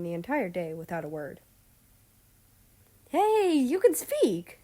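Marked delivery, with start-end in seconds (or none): 3.1-4.6 much higher, louder and slow, in a changed voice; surprised exclaim. Acted. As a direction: gasp it out in amazement. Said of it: "Fukushima exclaimed in surprise".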